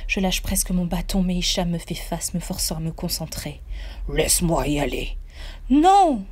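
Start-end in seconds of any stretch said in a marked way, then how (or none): 4.1-5.2 low plea from the sidekick; golem voice. A narrator's reading. Golem Hicham asking the narrator to let him go. 5.7-6.3 loud, high cry of no; shout. A narrator's reading. Narrator shouting no at golem Hicham. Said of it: "je crie".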